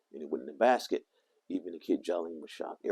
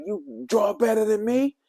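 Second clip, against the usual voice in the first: put-on much higher, louder, slow little-girl sulk; mad little girl; 7 dB louder, slower than usual. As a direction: whine it like an offended little girl. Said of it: "she got mad again".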